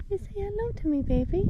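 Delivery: very high, soft cooing. Real, not acted. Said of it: "Don't mind my baby talk".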